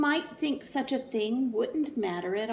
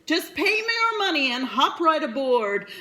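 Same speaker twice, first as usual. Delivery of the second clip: louder, higher huckster pitch, dropping at the end; salesman voice; 5 semitones higher, 5 dB louder than usual. Acted. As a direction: tempt them with a sly deal. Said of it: "salesman McBean again".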